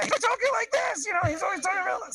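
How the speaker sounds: high-pitched rapid-fire comedic rant; Charlie Day impression. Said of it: "Charlie Day from".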